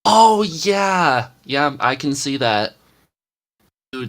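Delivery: ordinary talk, nothing marked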